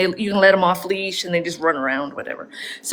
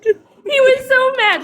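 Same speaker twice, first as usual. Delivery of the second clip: laughing; 3 dB louder, slower than usual. Real, not acted. Laughing while telling.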